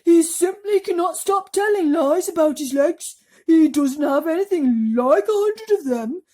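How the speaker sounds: high, loud grumbling worm; earthworm complaining. Acted. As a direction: worm grumbling about the centipede.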